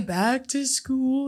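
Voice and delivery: high, very drawn-out goofy voice; silly movie impression. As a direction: stretch each word in a put-on voice. Singsong quote from the same film.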